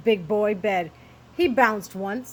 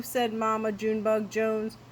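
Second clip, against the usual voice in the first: ordinary delivery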